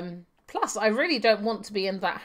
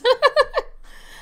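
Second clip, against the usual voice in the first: shrill burst of a giggle; high squealing laugh; 5 dB louder than usual. Real, not acted. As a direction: squeal with delight. Laughing at hidden babies.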